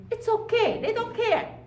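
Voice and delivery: put-on high, breezy childish voice; carefree id voice. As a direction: shrug it off like a lazy kid. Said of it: "And it say".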